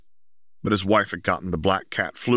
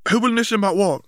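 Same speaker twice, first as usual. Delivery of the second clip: higher voiced Ron; 14 semitones higher, 5 dB louder, faster than usual. A narrator's reading. Ron arriving, high and loud.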